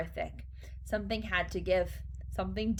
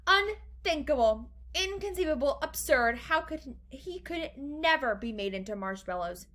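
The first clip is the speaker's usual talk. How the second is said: high protest, settling down by the end; exclaiming; 6 semitones higher than usual. A narrator's reading. Wonka denies he could become marshmallows, exclaiming. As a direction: protest each word emphatically. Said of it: "cried Mister Wonka".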